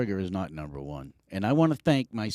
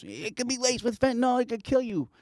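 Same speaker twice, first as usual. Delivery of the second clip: high, mock-alarmed warning; freaking-out wife. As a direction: imitate a worried wife pleading, pitched way up. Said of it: "she's like, she's freaking out".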